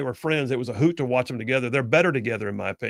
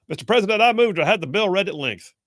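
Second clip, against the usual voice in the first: raised, urgent mock senator voice; senator making a motion; 6 semitones higher, 3 dB louder than usual. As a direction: call out a formal motion, pitched high. Acting out a floor motion.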